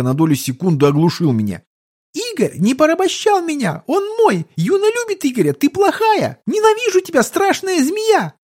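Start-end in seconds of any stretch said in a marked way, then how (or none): 2.1-8.3 very high, squealing tantrum; hysterical little girl. A narrator's reading. Child defending Igor against the snake woman, hysterical. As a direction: wail in protest like a tiny child. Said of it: "У мелкой началась форменная истерика".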